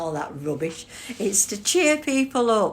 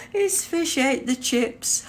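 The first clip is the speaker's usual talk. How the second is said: cracking up; 2 semitones higher than usual. Real, not acted. Streamer reading a viewer's joke aloud, laughing helplessly. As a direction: squeeze the words out through helpless giggles.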